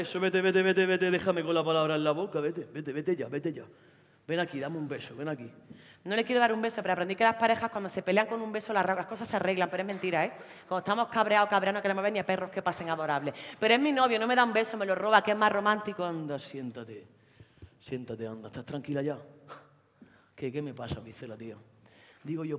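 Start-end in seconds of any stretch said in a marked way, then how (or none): 0.0-5.5 put-on sulky boyfriend. Boyfriend answering his girlfriend who walks off, sulky. 16.0-21.6 put-on condescending boyfriend. Boyfriend calming his girlfriend after the fight, patronising.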